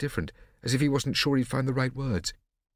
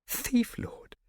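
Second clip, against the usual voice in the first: surprised. A narrator's reading. Surprised cry.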